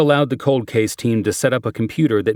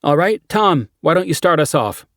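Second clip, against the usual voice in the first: lightly raised, brisk detective; brief the team like a cop; 8 semitones higher, 3 dB louder than usual. Acted. Character addresses team.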